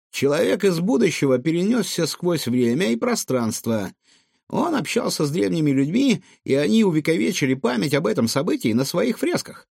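high, singsong little creature voice; high-pitched young know-it-all; small clever raccoon, eager. Acted. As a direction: explain excitedly in a squeaky pitch.

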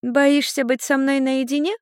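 put-on high, teasing child voice; laughing little girl. A narrator's reading. Little girl teases Ian about being alone, playful mockery. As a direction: dare him in a piping girlish voice. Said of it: "Люся рассмеялась".

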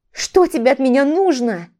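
heated and exasperated; angry demand. A narrator's reading. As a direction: demand to know what he wants. Ex-wife demands an answer.

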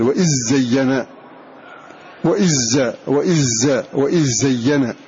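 وإذ زين said "wa-izzayyina", with a doubled ز; dh merged into z. Shown as a demonstration. Teacher drilling the merge for students: وإذ زين as "wa-izzayyina". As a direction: let the ذ melt into the ز of زين. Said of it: "هكذا".

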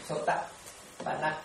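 Sotapanna spelled out slowly, each syllable apart, breathy and soft; slow careful Pali. Shown as a demonstration. Monk teaching the Pali word to listeners, slow and soft. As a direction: drawl out Sotapanna syllable by syllable, almost whispered. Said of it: "Hai chữ này".

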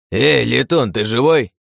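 loud gruff bandit call; calling out from cave. A narrator's reading. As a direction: shout it from a distance. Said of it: "раздалось из пещеры".

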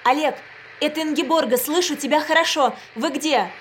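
high, loud, rushed radio call; blurted out. A narrator's reading. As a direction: fire the words out urgently. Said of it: "Ингеборга вышла в эфир и выпалила". (выпалила взволнованно)

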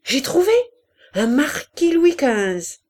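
high, loud cry of discovery, drawing out the idea; triumphant exclamation. A narrator's reading. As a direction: burst in with a brilliant idea. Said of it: "coupée par une exclamation de Malignon".

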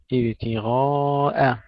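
slow ابتغاء, long heavy غا then thin hamza; light final hamza. Shown as a demonstration. Tajweed teacher modelling for students, drawn-out ابتغاء with light hamza. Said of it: "ننتبه إلى ترقيق الهمزة".

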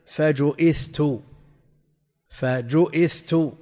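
فجئثت slowly as "fa-ju-ITH-tu", with a hamza; ضم الجيم وكسر الهمزة. Shown as a demonstration. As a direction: spell out فجئثت by ear: u on the jim, glottal stop, i, then th. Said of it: "بضم الجيم وكسر الم-، الهمزة". (نطق «فجئثت» ببطء بضم الجيم وكسر الهمزة)

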